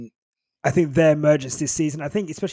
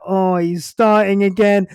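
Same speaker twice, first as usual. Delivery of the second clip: higher, louder, slower exasperated voice; mock exasperated partner; 5 semitones higher, 8 dB louder, slower than usual. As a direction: sigh it out as a fed-up partner. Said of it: "She's like".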